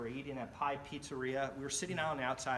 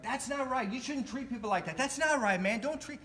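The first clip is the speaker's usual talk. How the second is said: protesting; 10 semitones higher, 5 dB louder than usual. Retold or imitated. Leonard protesting to police.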